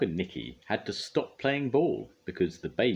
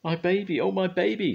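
high, louder cry of relief and fright; frightened mother; 13 semitones higher, 5 dB louder than usual. A narrator's reading. A mother after her baby nearly gets hit: high, louder frightened cry. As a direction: clutch at the words, shaken. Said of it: "said Missus Clutterbuck".

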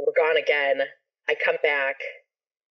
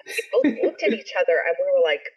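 ordinary talk, nothing marked

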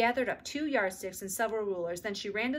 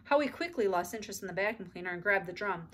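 ordinary delivery

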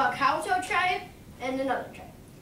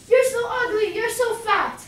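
louder, higher jeering taunt; mocking taunt; 8 semitones higher, 6 dB louder than usual. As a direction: jeer at the monster to provoke it. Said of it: "started making fun of the taniwa".

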